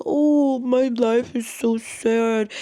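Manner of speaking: high, loud "Oh" then slow drawn-out self-pitying whine; mock sad victim. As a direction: ham up a sorry-for-myself wail. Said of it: "always the victim".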